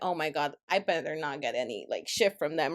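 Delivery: low, quiet aside to herself; wary inner thought. Retold or imitated. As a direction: think it under your breath, low and soft. Wary inner thought about new customers.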